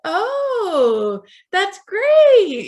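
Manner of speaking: mock-enthusiastic parents, high and loud; forced smile. Parents reacting to an unrealistic book dream, forced smile. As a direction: stretch it out, high and over-bright, through a forced smile. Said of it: "that forced smile in their voice".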